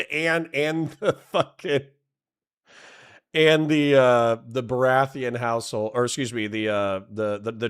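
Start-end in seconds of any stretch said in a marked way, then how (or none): 0.0-1.8 real giggles interrupting the words; breaking into laughter. Real, not acted. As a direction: stumble on through a fit of giggles. Cracks up while explaining.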